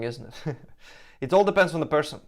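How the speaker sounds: ordinary talk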